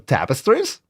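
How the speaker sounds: ordinary talk, nothing marked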